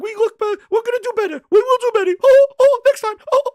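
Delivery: very high, loud cartoon falsetto; squeaky, stammering falsetto full of "oh" yelps; Mickey Mouse voice. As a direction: apologize like a flustered squeaky mascot. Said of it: "Phil's like".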